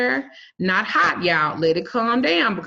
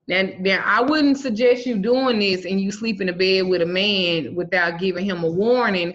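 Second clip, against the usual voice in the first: normal talking voice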